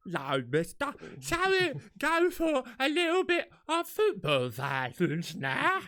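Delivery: slow, high, hammed-up fake Cockney; bad Cockney. Mocking a film hooligan's awful accent, exaggerated bad Cockney. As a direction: do a dreadful drawn-out Cockney. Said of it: "they get him to be the Cockney".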